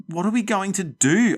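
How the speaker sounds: high, loud panicked wail. Acted. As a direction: cry it out in despair. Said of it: "I wailed".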